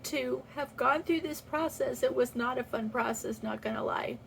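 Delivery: normal talking voice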